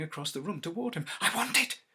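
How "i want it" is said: usual speaking voice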